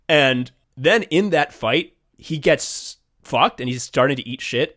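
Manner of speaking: usual speaking voice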